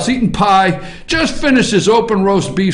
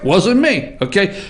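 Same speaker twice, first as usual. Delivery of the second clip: usual speaking voice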